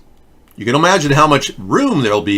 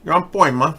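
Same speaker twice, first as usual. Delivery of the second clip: Joe Pesci voice; 5 semitones higher than usual. Acted. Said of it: "Joe Pesci says".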